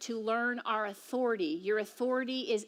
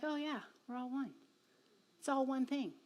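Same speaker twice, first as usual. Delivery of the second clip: casual realization; 8 dB quieter than usual. Retold or imitated. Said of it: "You're like".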